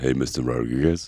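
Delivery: low, sultry "Hey, Mister Rodriguez"; sexy voice. Pretend. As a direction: try to sound seductive. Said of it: "see if it has the same effect when I do it".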